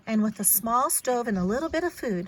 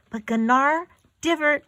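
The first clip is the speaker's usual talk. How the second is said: usual speaking voice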